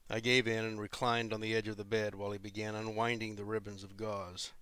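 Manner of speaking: ordinary delivery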